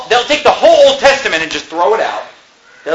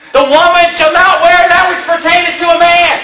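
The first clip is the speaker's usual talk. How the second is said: high, loud preaching shout; shouted verse; 8 semitones higher, 4 dB louder than usual. Acted. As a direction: hurl the verse at them. Thundering Scripture at opponents.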